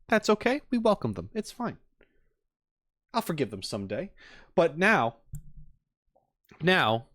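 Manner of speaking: ordinary talk, nothing marked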